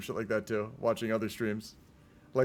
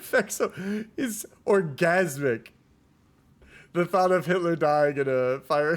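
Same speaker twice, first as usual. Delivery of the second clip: laughing; 3 semitones higher, 5 dB louder, slower than usual. Real, not acted. Laughing at a game event.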